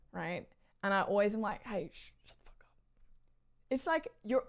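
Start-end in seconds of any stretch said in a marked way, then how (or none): 1.6-2.7 urgent hushed whisper; whispered scolding. Retold or imitated. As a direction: whisper it to shush a friend on a train. Quietly scolds friends on a train.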